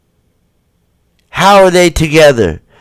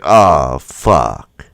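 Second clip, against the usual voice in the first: imitating a stunned guy, low and deflated; low dismayed groan; 13 semitones lower, 3 dB quieter than usual. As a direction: sink the voice, mock dismay. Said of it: "he looks around like".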